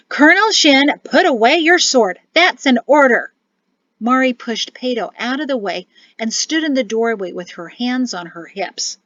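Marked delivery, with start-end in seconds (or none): 0.1-3.3 loud, high commanding shout. A narrator's reading. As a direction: bark the order loudly. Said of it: "A voice shouted".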